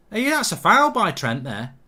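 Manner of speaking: loud, high cry of foul; indignant protest. Real, not acted. Protests a foul during the match.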